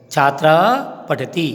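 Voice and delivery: normal talking voice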